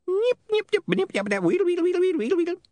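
squeaky high rewinding chatter; tiny creature whirring backwards; high squeaky imp babble; imp rewind noise. A narrator's reading. There was a muffled ouch.

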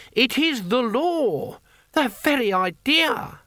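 put-on very high-pitched elderly pedant; indignant, crisp. A narrator's reading. Elderly law lecturer objects to the nomination, indignantly. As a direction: recite the rules in precise outrage. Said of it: "clipped as topiary, crisp as biscuits".

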